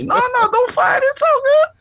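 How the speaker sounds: hammed-up high voice; squeaky falsetto. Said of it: "Italian New York accent come up to you".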